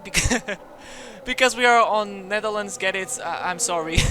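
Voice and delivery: giggling through his own joke; laughing. Real, not acted. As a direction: laugh it off, apologetic.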